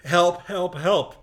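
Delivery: loud repeated cries for help; desperate calls for help; retold yells for help. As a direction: shout help over and over. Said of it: "as loud as I could".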